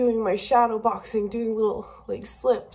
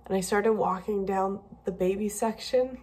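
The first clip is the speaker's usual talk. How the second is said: normal talking voice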